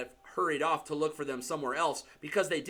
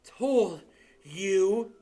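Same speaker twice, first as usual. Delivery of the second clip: breathless gasp, higher and louder; 5 semitones higher, 6 dB louder than usual. A narrator's reading. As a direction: gasp it out, winded. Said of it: "Hermione gasped".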